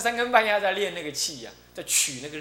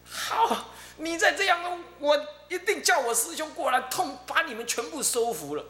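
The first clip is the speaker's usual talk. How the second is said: threatening the spirits; 10 semitones higher than usual. Retold or imitated. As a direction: threaten them with your senior. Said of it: "指着虚空说".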